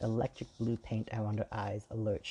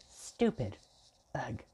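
indignant exclamation; 3 semitones higher, slower than usual. A narrator's reading. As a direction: exclaim it in offended disgust.

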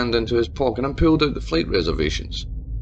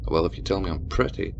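soft, playful tease; teasing; 7 semitones lower, 5 dB quieter than usual. Acted. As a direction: tease him coyly. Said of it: "Tabitha teased".

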